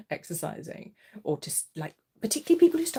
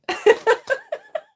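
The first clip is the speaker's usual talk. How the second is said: high laugh; 12 dB louder than usual. Real, not acted.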